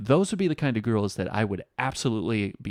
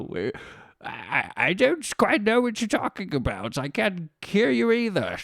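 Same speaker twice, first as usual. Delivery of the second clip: put-on high, slow old man voice; 8 semitones higher than usual. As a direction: play a confused old man. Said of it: "And then you go".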